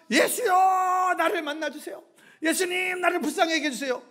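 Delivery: high, pleading mock yell; crying out. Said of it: "이쪽에다 소리를 지르는 거예요". (애타게 높은 소리로 부르짖는 흉내)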